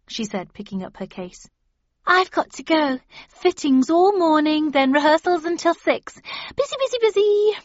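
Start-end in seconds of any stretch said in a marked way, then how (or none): 2.0-7.5 high, breezy and busy; bustling actress voice. Acted.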